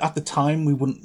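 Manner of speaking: ordinary delivery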